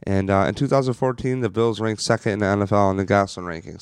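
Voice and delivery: ordinary talk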